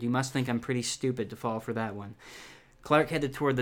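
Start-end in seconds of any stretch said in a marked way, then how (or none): none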